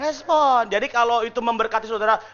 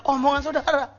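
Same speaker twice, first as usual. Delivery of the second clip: ordinary delivery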